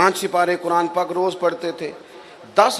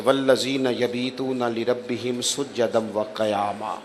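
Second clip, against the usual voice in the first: slow, soft, lower recited verse; Quran recitation; 4 semitones lower, 4 dB quieter, slower than usual. Acted. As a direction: draw out the verse in reverent recitation. (دھیمی، آہستہ، نیچی آواز میں آیت کی تلاوت)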